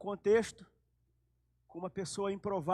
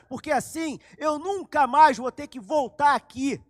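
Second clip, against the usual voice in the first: loud pleading; 6 semitones higher, 11 dB louder than usual. Acted. Samaritan woman begging Jesus for the water, loud and high.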